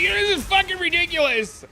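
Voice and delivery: very high squealed complaint; mock exasperation. Opponent protests being blocked by the same trick, mock exasperation. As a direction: imitate a sore loser whining in outrage.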